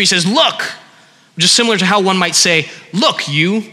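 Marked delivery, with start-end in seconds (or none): 0.3-0.6 a short, higher, rising "Look" tossed at his father; curt disrespectful look. Retold or imitated. Son snapping at his father, curt and disrespectful. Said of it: "impersonal and disrespectful". 2.9-3.6 "Look" leaned on and rising, "you" tacked on dismissively. Retold or imitated. Son addressing father like a servant, dismissively. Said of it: "impersonal and disrespectful".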